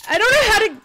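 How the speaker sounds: high, loud, frantic; panicked yell. Real, not acted. Streamer yells in panic fleeing the beast. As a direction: blurt it out in panic.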